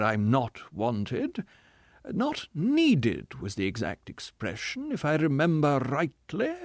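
sarcastic hurt pride, slow and high; tragedian. Acted. Tragedian mocking reply.

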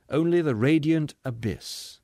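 usual speaking voice

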